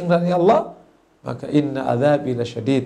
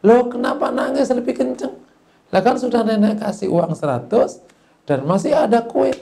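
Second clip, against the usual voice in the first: high-pitched astonished questioning; puzzled grandmother; 7 semitones higher than usual. Acted. Granny asks child crying harder despite gifts, high puzzled voice. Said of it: "Dipanggil sama nenek".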